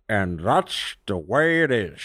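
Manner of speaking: low, slow, bad newscaster impression; Walter Cronkite. Host closing the show with immortal words, bad anchorman impression. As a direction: intone a slow, deep anchorman sign-off. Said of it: "my really bad Walter Cronkite impression".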